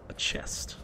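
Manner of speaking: slow hushed whisper. Real, not acted. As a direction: breathe it out secretly. Spots loot quietly.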